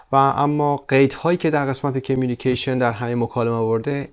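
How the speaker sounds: ordinary talk, nothing marked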